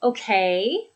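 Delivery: "Okay" swooping up at the end; encouraging, questioning lift on "Okay"; rising intonation. Shown as a demonstration. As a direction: draw out the end of "Okay" as it rises. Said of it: "that rising intonation".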